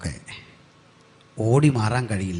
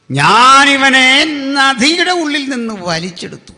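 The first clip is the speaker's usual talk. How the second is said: high, drawn-out declamation; acted proclamation; 14 semitones higher, 10 dB louder, slower than usual. Woman who named Moses declares the rescue, acted proclamation. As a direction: proclaim it high, easing off at the end.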